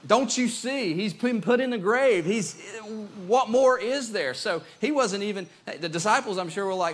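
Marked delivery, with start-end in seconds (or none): 0.1-4.3 high, loud, exasperated outburst; fed-up doubter. Acted. Thomas telling disciples that Jesus is dead, acted exasperation. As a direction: insist it is all over, voice raised. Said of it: "he was over it".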